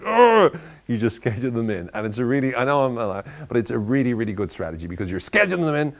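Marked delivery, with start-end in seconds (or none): 0.0-0.6 very high, loud groan of disgust; imitating a partner's exasperated groan; mock exasperated ugh. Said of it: "they're like".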